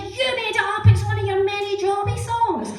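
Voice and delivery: high, loud retold reply; exclaimed retort. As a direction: voice the friends calling it out emphatically. School friends' exclaimed answer retold.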